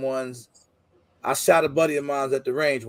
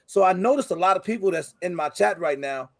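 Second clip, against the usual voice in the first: ordinary talk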